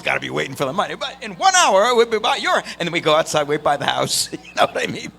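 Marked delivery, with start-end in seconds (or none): 0.9-2.6 game show host voice. Retold or imitated. Pranksters promising the winner a delivery, hammed-up host. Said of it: "character voices".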